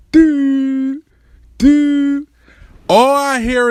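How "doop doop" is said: high, held "doop" dial tone; phone ringing noise. Retold or imitated. Storyteller mimicking the call to the ex, imitated ringing. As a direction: imitate a ringing line with long level toots. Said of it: "I called her again".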